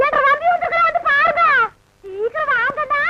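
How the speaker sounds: high-pitched, excited calling; excited summons. Acted. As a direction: call everyone over to see your brother, voice pitched high. Calls household to see her brother. (உற்சாகமாக எல்லோரையும் அழைத்தல்)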